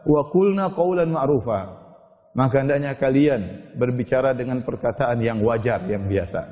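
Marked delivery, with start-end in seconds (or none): 0.1-1.7 slow, drawn-out chanted Arabic; Quranic recitation. Real, not acted. Preacher recites the verse to the women, slow chant. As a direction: intone the verse slowly and evenly.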